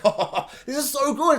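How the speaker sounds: giddy, delighted laugh. Real, not acted. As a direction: savour the moment with a laugh. Delighted reaction to the scene.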